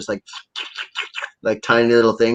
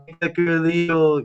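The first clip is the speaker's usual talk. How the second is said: put-on drunk demand; 6 semitones higher than usual. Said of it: "I'm like".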